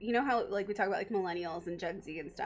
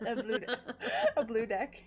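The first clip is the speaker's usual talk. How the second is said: laughing; 3 semitones higher than usual. Real, not acted. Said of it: "my deck's blue".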